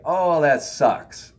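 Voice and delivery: very slow, drawn-out sympathetic reply; sympathy. Retold or imitated. Answering a venting friend, slow sympathetic reply. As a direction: stretch out the sympathy. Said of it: "you'd be like".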